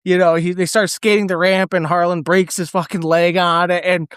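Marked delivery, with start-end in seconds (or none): none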